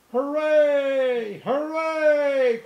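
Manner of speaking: very high, loud joyful cheer; cheering hooray. A narrator's reading. Toys cheering as they reach the top, loud high shout. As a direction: cheer at the top of your lungs. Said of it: "cried the little clown".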